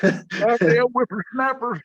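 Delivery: high, slow cranky codger voice; cranky old man. Pretend. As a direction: play a grumpy old geezer. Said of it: "old man yelling at a cloud".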